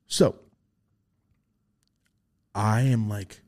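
ordinary talk

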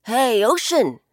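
a loud call across open water. Acted. Blackbird hovering and calling down to the ocean, loud. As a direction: call out to get the ocean's attention. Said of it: "he called out".

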